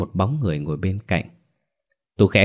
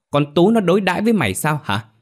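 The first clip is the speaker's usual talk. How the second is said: much higher, emphatic angry question; escalating fury; 10 semitones higher, 4 dB louder, faster than usual. A narrator's reading. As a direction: push the repeated question up into outrage. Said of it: "Má Bảy lúc này nổi điên".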